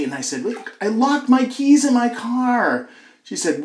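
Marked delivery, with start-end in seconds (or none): 0.4-2.9 slow, high call across the house; retold shouting. Husband explaining to his wife from the doorway, calling out. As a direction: call it out, stretched and pitched up. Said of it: "So I shouted".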